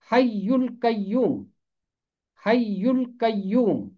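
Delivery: حی القیوم said slowly, each syllable of حی and القیوم clear and separate; correct pronunciation. Shown as a demonstration. Teacher showing students the right way to say حی القیوم, slow and careful. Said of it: "یہ ہے اس کا درست تلفظ".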